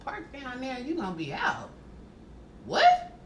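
incredulous repeat. Retold or imitated. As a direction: mutter it back, then shriek What. Mocking his request.

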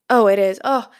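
sudden loud relieved realization; relieved surprise. Real, not acted. Podcaster finds her mic was on after all, loud relief. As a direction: blurt it with relief.

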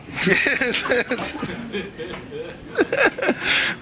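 high, long laugh; laughing at his own joke; hearty laugh. Real, not acted. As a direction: laugh at the punchline.

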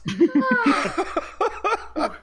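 long falling high laugh; high giggling fit; high helpless laugh. Real, not acted. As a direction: crack up completely. Laughing after sniffing.

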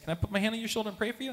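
softly and quickly asking; a gentle hushed request; quiet ask. Retold or imitated. Friend asking to pray over him, quick and soft. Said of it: "he says".

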